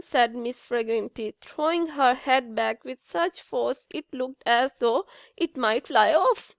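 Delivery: ordinary delivery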